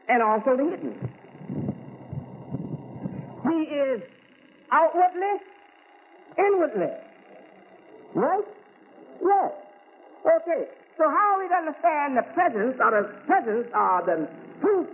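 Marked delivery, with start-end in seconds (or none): 3.5-14.9 higher, raised preaching voice. Acted. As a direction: lift it up and preach to the congregation. Preaching, calling to congregation.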